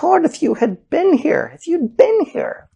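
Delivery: high plea. Acted. Mary's plea quoted again.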